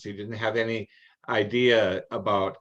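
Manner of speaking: usual speaking voice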